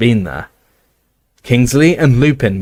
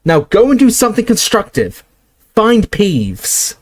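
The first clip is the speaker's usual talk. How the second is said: sharp order; 10 semitones higher than usual. Acted. Ordering Filch.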